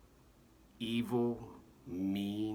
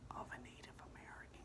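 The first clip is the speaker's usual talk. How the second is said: breathy high whisper, fast; 14 dB quieter, much faster than usual. Shown as a demonstration. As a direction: whisper it like a guilty aside. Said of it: "you start to, you know, whisper".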